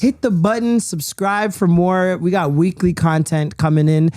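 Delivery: loud announcer-style plug; podcast plug. Acted. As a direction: sell the subscribe pitch.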